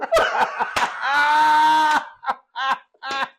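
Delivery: loud, high, long belly laugh; real helpless laughter; cracking up. Real, not acted. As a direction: burst out laughing at your own punchline. Cracks up at his punchline.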